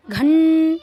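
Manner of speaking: घं very loud, a long ringing nasal n; drawn-out anusvara. Shown as a demonstration. Teacher showing the changed sound, held nasal. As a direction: hold the hum of घं loudly. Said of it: "कैसे उच्चारण बदल गया".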